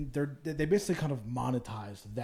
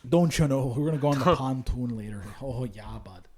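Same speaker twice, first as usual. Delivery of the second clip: hammed-up Upper Midwest accent, louder; Wisconsin Canadian-ish accent; 3 dB louder than usual. Host mocking Wisconsin folks in a hammed-up Upper Midwest accent. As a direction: lean into the Wisconsin "oh yeah, bud" accent. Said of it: "They talk more Canadian".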